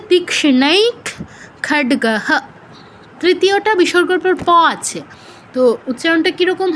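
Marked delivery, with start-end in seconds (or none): 0.1-2.3 তীক্ষ্ণৈক closed on kh, pause, খড্গহ with full "ha"; kh, then full h. Shown as a demonstration. Teacher recapping the rule, তীক্ষ্ণৈক in kh, খড্গহ in "ha". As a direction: split the words, খড্গহ ending in a clear "ha". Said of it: "উচ্চারণটা কী হচ্ছে?".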